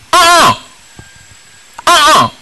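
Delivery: high, loud disapproving uh-uh; firm no. Real, not acted. Preacher to congregation refusing the coincidence excuse, high disapproving uh-uh. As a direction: shut the excuse down.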